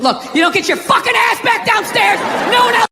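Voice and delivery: a hint of a rough father barking; gruff dad. Father ordering the kid downstairs, mildly voiced gruff. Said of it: "He softened up".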